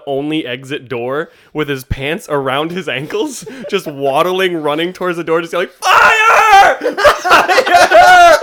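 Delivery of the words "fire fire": frantic high shout of Fire; yelling. Retold or imitated. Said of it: "just yelling fire".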